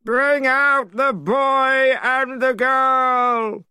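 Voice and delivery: high, very slow loud call; loud cry. A narrator's reading. Servant calling loudly into the house, high and slow. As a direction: bellow it out to the whole house. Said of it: "A loud voice cried".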